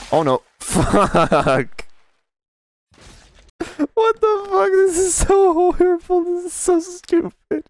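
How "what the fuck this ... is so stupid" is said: much higher, whining outrage; high whiny disbelief. Real, not acted. Gamer whining high at a horrible crash. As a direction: whine it high and aghast. Shocked at game.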